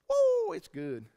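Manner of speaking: high, loud "woo" that drops away; exulting whoop. Real, not acted. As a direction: whoop with delight at your own message. Exults over his coming message.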